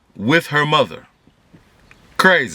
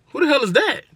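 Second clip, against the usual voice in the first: baffled question; 11 semitones higher than usual. Retold or imitated. Hearing an unknown name.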